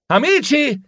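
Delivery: high-pitched excited call. A narrator's reading. Hero struck by an idea calls his friends, crying out. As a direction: cry out to your friends.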